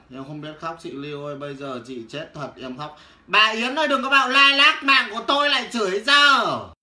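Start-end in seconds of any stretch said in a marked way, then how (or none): 3.3-6.7 loud high shout. Real, not acted. Seller yelling at a viewer mid-livestream. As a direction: yell it across the room.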